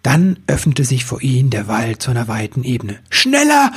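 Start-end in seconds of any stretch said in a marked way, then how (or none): 3.1-3.7 urgent shout over the wind. Acted. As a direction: yell to drive the weasel on. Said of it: "brüllte Ribbelwitsch".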